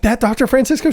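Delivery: high, thrilled disbelieving ask; high excited voice asking about the famous doctor; starstruck question. Retold or imitated. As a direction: squeal it like meeting a celebrity.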